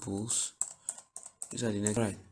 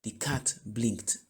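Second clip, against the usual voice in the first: slow model sentence; slower than usual. Shown as a demonstration. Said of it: "blink and you say".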